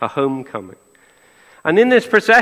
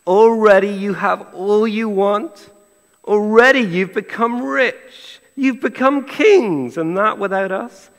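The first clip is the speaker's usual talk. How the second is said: mock-impressed, higher and slow, leaning on each boast; sarcastic; 4 semitones higher than usual. Preacher reading Paul's jibe to the congregation, sarcastic. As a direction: read it with slow, rising mock admiration. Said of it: "Paul here in verse eight is being sarcastic".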